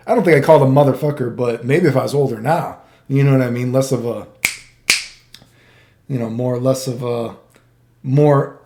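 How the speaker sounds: ordinary talk, nothing marked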